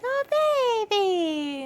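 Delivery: high, loud imitated gushing over a cat; cooing coworkers. Imitating colleagues gushing at the cat on camera. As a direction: gush like colleagues seeing a cute cat. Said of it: "they were all like".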